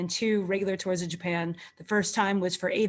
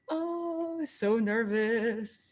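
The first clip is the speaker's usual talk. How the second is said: nervous; 2 semitones higher, 5 dB quieter, much slower than usual. Retold or imitated. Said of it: "And I'm just like".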